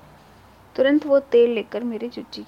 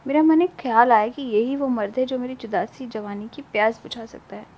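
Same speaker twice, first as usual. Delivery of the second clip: ordinary delivery, nothing marked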